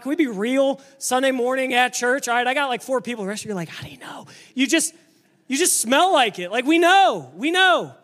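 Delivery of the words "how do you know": whispered, skeptical aside; mock whisper. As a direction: whisper it like a doubting listener. Skeptical listeners whisper back.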